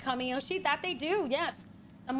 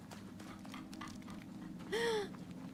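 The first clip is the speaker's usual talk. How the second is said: dramatic whispered gasp; 10 semitones higher, 12 dB quieter than usual. Real, not acted. Gasp at password strength.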